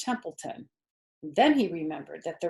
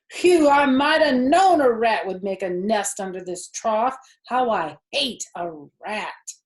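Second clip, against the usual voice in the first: disgusted farmer; 4 semitones higher, 8 dB louder than usual. Acted. Farmer disgusted at rat nest.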